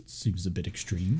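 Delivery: underplay it, totally unbothered; deadpan understatement. Retold or imitated. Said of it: "and James goes".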